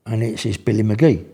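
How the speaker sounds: ordinary delivery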